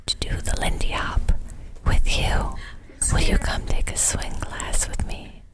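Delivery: put-on breathy old granny whisper to chickens; grandma whispering. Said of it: "grandma's just whispering".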